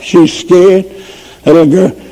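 usual speaking voice

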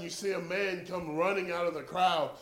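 ordinary talk

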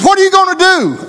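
high, loud alarmed squeak; alarmed Brother Jim. Retold or imitated. Brother Jim asks the preacher, high-pitched and alarmed.

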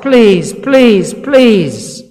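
father begging Jesus, rising to a loud plea; imploring cry. Retold or imitated. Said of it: "he begs and he implores".

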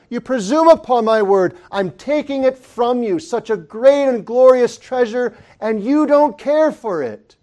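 loud, high, stern divine rebuke; God rebuking. Retold or imitated. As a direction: thunder it as God scolding his people. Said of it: "as if God were speaking".

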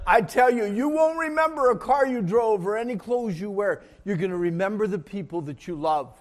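loud, high, emphatic preacher's declaration; full-force preaching. Real, not acted. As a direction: drive the lesson home to the back row.

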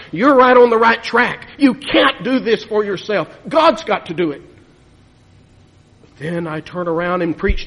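loud, driving pulpit delivery; full-force preaching. Real, not acted. Preacher to his congregation, urging them, in a loud, driving voice. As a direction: hammer the point home to the congregation.

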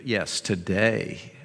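soft, low reassurance; gentle forgiveness. Retold or imitated. Jesus answering the criminal beside him, gently and quietly. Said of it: "offers him words of forgiveness".

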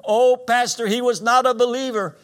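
high, loud mock wail; imitated sobbing. Preacher acts lady grieving her daddy to pastor, wailing in imitation. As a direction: act a distraught daughter wailing without let-up. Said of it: "she's sobbing".

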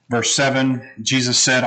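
normal talking voice

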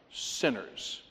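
"Sinners" whispered, low and soft for weight; whispered emphasis. Real, not acted. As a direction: breathe the word "Sinners" like a secret. Stressing who Christ came to save.

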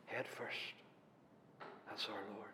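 hushed whisper of awe. Acted. Preacher at the cross, whispering in reverence. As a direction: breathe it, almost soundless. Awed hush after the cross.

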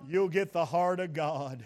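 ordinary talk, nothing marked

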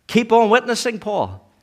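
rally him with a loud cry; preacher urging Paul to keep on witnessing, shouting; preacher's shout. Acted.

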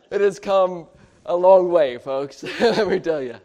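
loud, punchy joke to the crowd; amused exclamation. Real, not acted. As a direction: boom it out, breaking into a laugh. Jokes about Chicago and Nashville traffic.

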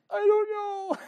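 high, squeaky mock wife voice; husband asks, wife squeaks back in high mock voice; wife's answer.

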